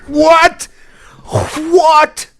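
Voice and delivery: high-pitched cry of disbelief; shocked. Real, not acted. Streamer hearing of yet another coincidence, shocked. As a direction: blurt it out high, astonished.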